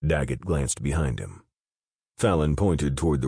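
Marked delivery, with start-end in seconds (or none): none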